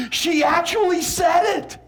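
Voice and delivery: high, slow scandalised outcry; disbelieving. Shown as a demonstration. First grader reporting a classmate's bad words, disbelieving. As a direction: cry it out as if you can't believe it. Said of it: "like you just can't believe it".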